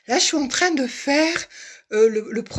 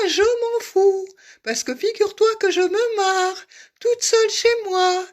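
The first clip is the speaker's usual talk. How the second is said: voix aiguë et ravie, presque criée; exaltée; 8 semitones higher, 3 dB louder than usual. Real, not acted. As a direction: lance ça avec une joie provocante. Provoque Manu, ravie. (exaltée et moqueuse)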